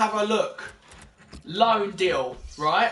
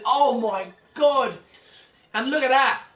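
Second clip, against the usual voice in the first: real shock; 4 semitones higher, 4 dB louder than usual. Real, not acted. Shock at transfer news.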